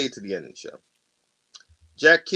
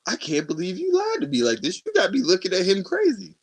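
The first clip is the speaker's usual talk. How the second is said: raised-pitch imitation of indignation; indignant rebuke; 8 semitones higher than usual. Friend rebuking the ex for lying, indignant. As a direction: scold her in a higher voice.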